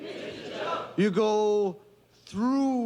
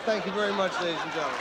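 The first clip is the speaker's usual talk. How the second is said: ordinary delivery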